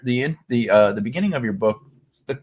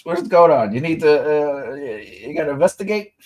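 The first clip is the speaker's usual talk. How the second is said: ordinary talk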